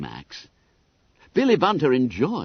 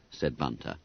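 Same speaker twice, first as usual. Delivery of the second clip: plain reading voice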